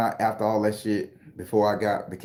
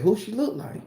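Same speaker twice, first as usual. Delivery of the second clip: startled question; 12 semitones higher than usual. Retold or imitated. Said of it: "He said".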